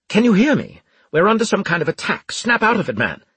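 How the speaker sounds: high, loud officer's urgency; urgent rousing shout. A narrator's reading. Officer to a reviving soldier during an attack, urgent shout. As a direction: raise the voice sharply to rouse him.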